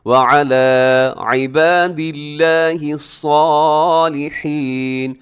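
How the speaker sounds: slow chanted व अला इबादिल्लाहि with a clear ain; drawn-out सालिहीन; tajweed recitation. Shown as a demonstration. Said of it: "आइन का ख्याल रखने से".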